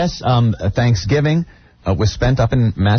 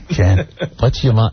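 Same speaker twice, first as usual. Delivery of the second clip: normal talking voice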